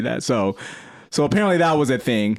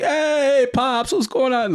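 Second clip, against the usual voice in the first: put-on much higher, sing-song drunk hello; drunk greeting; 13 semitones higher, much slower than usual. Drunk son greeting dad in the kitchen, high and cheerful. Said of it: "apparently I'm like".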